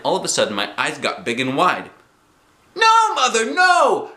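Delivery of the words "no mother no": very high, loud protest; shouted. A narrator's reading. Girl stops Mother cutting swim ring, shouting. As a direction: scream in alarm. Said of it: "I shouted".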